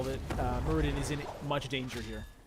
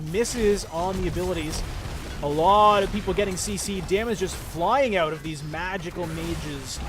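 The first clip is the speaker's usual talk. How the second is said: excited play-by-play; 3 semitones higher, 7 dB louder than usual. Acted. Hype play-by-play of teamfight.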